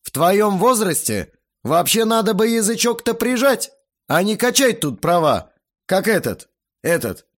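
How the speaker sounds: loud, high rant that falters at the end; angry scolding. A narrator's reading. Guest lectures the teenager, indignant and then stuck. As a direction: scold hard, then fumble for the word.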